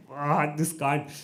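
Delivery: mock fury boiling over; angry outburst. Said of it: "deep down, I'm so angry".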